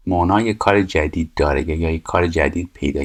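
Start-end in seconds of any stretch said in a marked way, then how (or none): none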